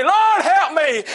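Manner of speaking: high, loud, drawn-out plea; loud crying out. Acted. Blind Bartimaeus calling to Jesus as he passes, crying out loud. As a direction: cry it out slowly at full preaching voice. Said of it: "cry out real loud, say".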